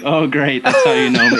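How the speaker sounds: sarcastic great, breaking up laughing; laughing mock dismay. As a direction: groan at the joke while laughing. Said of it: "And I'm like".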